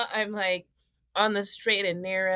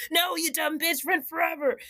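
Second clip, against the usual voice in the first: raised, higher mock yell; mock scream at past self; 6 semitones higher than usual. Scolding her past self, a higher mock yell. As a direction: yell at your younger self to rent. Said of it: "start screaming at my past self".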